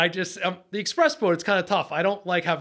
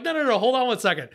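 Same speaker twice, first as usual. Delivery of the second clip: hurried stall; 6 semitones higher, faster than usual. Retold or imitated. Stalling his boss.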